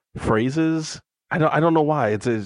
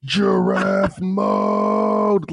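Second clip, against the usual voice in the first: stretched-out hammed-up battle cry; retold scream; 8 semitones higher, much slower than usual. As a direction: bellow it like a fan losing it. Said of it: "I screamed out".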